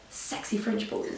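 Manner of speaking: voiceless, very soft whisper; whispered quote. Retold or imitated. Friend heard through an open door, whispered. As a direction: whisper it as overheard words. Overheard words voiced in hushed tone.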